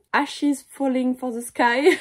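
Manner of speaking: slow, careful attempt at an English accent. Shown as a demonstration. Vlogger warns viewers before reading a title, effortful English accent. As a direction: draw out the title in your best English accent. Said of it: "mon accent anglais, préparez-vous".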